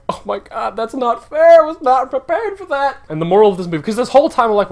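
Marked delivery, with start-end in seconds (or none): 0.1-3.0 1 overwhelmed. Retold or imitated.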